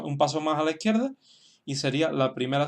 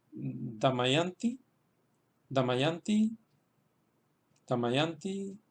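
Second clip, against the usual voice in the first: "Damayanti" accented on the third syllable, YAN; careful demonstration of "Damayanti", YAN stressed; much slower than usual. Shown as a demonstration. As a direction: model "Damayanti", leaning on a long YAN. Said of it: "el acento se queda en la sílaba yan".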